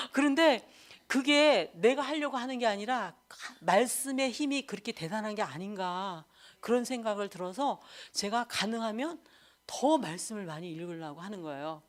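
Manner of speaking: ordinary talk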